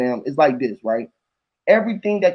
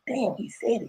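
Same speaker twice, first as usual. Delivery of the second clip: hushed mock aside; 7 semitones higher, 10 dB quieter than usual. Imitating inner reaction.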